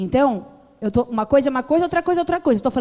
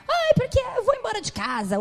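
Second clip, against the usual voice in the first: high, whiny imitation of a complaining mother; mother's whiny complaint; 11 semitones higher than usual. Preacher mimics her mother threatening to leave home, high whiny voice.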